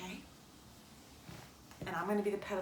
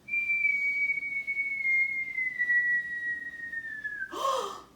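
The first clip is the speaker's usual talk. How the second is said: gasp; 5 dB louder than usual. Acted. Peddler sees caps thrown down.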